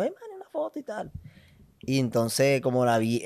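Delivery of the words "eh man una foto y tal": put-on high-pitched kid voice, far from his own; excited kid asking. Excited kid asking him for a photo, squeaky. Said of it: "un peladito me reconoció".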